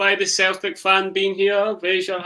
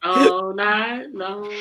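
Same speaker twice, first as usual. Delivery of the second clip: mock slow repeat; much slower than usual. Mocking repeat of line.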